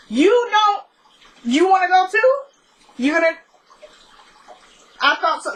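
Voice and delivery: mock boss. Mock-firing her imaginary staff.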